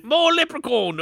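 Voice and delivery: hammed-up Irish accent drifting Australian; leprechaun. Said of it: "your leprechaun voice".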